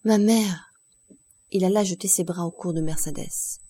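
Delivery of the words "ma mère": a louder heartfelt cry of "Ma mère!". A narrator's reading. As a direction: call out to her as you see it all. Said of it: "s'écriant".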